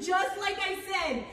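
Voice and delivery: ordinary talk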